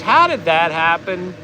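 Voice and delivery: normal talking voice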